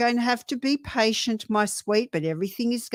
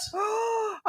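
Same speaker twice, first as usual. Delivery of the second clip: sharp, high intake of breath; shocked gasp; 12 semitones higher, 7 dB quieter than usual. Real, not acted. Hearing of the New Zealand earthquake, a shocked gasp. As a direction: gasp at sudden bad news.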